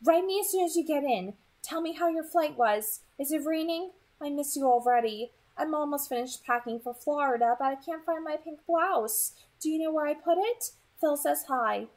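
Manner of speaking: high, bright-voiced fussing mom; lightly chirpy mother's email voice; mom's voice. A narrator's reading. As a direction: read it as an anxious mother. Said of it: "my mom wrote".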